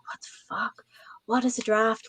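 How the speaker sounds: baffled whisper to oneself; hushed, clueless aside; whispered bafflement. Retold or imitated. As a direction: whisper it in mock disbelief. Retold panicked inner reaction to order.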